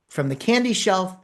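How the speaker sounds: ordinary talk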